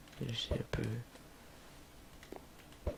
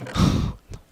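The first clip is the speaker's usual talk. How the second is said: burst of laughter; 12 dB louder than usual. Real, not acted. Laughs at own mishap.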